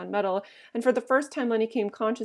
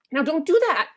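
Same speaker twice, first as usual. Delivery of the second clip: loud, higher-pitched order; angry; 7 semitones higher, 8 dB louder than usual. A narrator's reading. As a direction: order her sharply to stop. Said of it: "Lenny grew angry".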